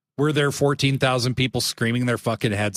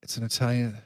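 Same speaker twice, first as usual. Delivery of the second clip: ordinary talk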